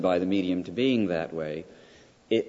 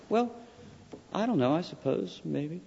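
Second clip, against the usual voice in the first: hesitant, higher-pitched maybe; noncommittal reply; 8 semitones higher, 4 dB quieter than usual. Acted. Answering someone who asks you to see a film, noncommittal. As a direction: waver softly between yes and no.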